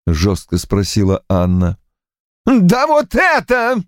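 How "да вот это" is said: slow furious yell. A narrator's reading. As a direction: yell it, pointing. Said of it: "завопил он".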